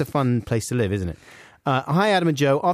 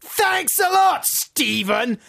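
mock outrage; much slower than usual. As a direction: yell the thanks sarcastically.

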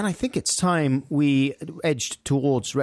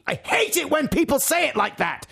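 high-pitched comic complainer; mock indignant outburst, pitched way up; Gerard. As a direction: squeal the complaint in a put-on voice. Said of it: "says Gerard".

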